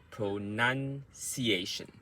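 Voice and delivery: pronunciation slowly in parts, pro, NUN, ciation; slow practice rhythm. Shown as a demonstration. Coach modelling the rhythm, pronunciation slow and broken up. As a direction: draw out pronunciation, stressing nun. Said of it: "you have to be slow".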